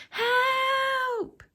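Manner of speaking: put-on high loud cry for help as a boy; a tumbling boy's high cry; cried. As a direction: call out falling through the sky. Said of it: "he cried".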